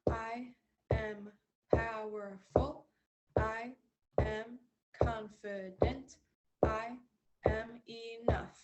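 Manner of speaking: slow, deliberate affirmations; slow mantra. Shown as a demonstration. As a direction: say each word slowly and firmly. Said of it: "Repeat after me".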